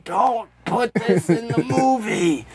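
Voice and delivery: slow, strained protest; mock dying plea. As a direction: drag it out like a dying man's protest. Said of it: "He might have been saying".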